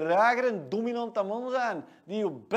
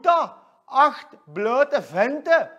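slow, loud disgusted exclamation; mock outrage; 4 semitones higher, 10 dB louder, much slower than usual. [name] mocking the male strippers, slow loud disgust. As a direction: spell it out in disbelief.

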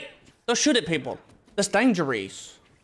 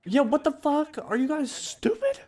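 mock exasperated scold; 12 semitones higher than usual. Real, not acted. Scolding game thugs.

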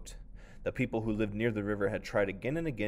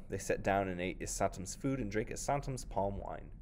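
plain reading voice

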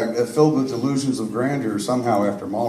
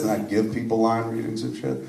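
normal talking voice

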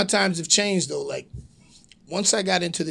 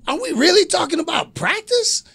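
high, loud, incredulous athlete; Allen Iverson; 7 dB louder than usual. Acted. Wrestler cutting a borrowed promo, high-pitched loud disbelief. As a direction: play an indignant pro athlete in disbelief. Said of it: "I borrowed from Allen Iverson".

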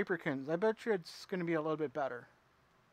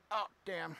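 normal talking voice

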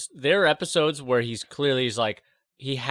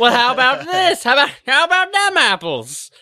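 high, loud gloating yell; mocking taunt; 13 semitones higher, 8 dB louder than usual. Trickster springing a twisted wish on someone, taunting. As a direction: throw it in their face, getting higher.

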